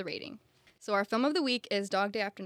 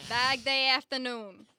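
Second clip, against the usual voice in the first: slow Brooklyn drawl of Dog Day; a hint of Brooklyn, stretched out; Brooklyn accent; 3 semitones higher, slower than usual. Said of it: "speak in a Brooklyn accent".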